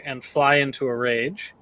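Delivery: normal talking voice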